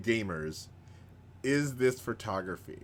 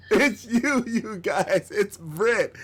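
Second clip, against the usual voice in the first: higher and louder, still thrilled; excited; 9 semitones higher, 6 dB louder, slower than usual. Real, not acted. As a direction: keep riding the happy reveal. Still announcing Brett's win.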